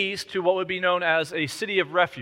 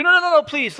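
much higher, louder no no no please; pleading protest; 10 semitones higher, 7 dB louder than usual. Retold or imitated. Widow begging the king, pleading and high. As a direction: beg him to stop, pitched up.

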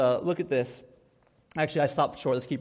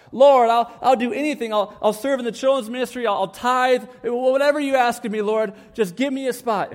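loud, higher frantic begging; desperate begging; 5 semitones higher, 5 dB louder than usual. Acted. Late man in a parking lot begging God for a spot, loud and frantic. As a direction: beg God as if the meeting depends on it.

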